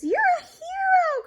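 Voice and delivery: high loud cheer. Acted. Animals cheering for rooster. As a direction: cheer the hero. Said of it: "cried the animals".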